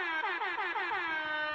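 high, soft wheezing laugh; real laugh tailing away quietly. Real, not acted. Co-host cracks up at the mannequin joke, laughing.

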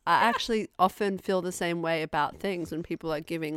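ordinary talk, nothing marked

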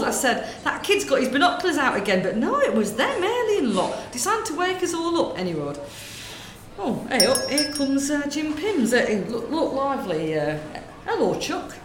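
lightly voiced gossiping northern woman, chatty; northern shop lady. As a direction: natter away like a corner-shop lady. Sketch, same shopkeeper.

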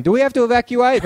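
high, hurried put-on question; mock-fretful and quick; worried neighbours. Worried neighbours walking out ask.

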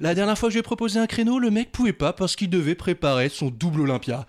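upbeat presenter read; show host intro. Acted. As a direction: hype up the guest like a host.

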